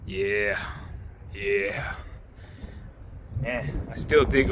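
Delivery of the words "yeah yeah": words buffeted by outdoor wind; wind on mic. Real, not acted. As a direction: wind gusting over the mic. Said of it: "sorry about the wind noise".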